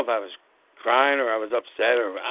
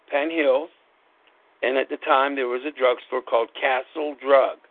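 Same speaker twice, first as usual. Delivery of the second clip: ordinary talk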